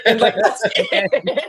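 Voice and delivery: laughing it out high; amused giggle while talking. Real, not acted. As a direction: giggle through the line.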